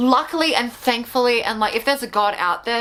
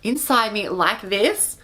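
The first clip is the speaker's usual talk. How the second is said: ordinary delivery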